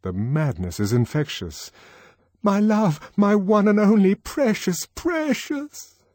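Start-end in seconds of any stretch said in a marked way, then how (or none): 2.4-6.0 much higher, gushing endearments; doting lover. Acted. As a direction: gush over them, high and syrupy.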